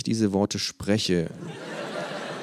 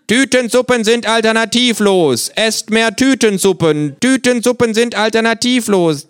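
mock propaganda slogans; 10 dB louder than usual. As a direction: parrot the state herald like a broadcast.

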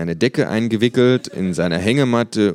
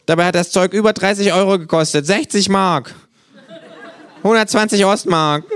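higher, grumbling tirade; ranting; 11 semitones higher, 5 dB louder than usual. Acted. Sick kangaroo railing at useless, costly remedies, exasperated rant. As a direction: rattle off the list in rising exasperation. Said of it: "und schimpft dabei".

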